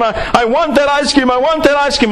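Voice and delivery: lightly acted kid demanding a treat; tantrum-throwing kid. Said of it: "screams and shouts and carries on".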